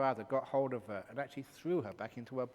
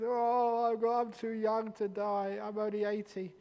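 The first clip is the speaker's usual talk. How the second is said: mock whiny old man; 9 semitones higher, 8 dB louder, slower than usual. Old person fearing death.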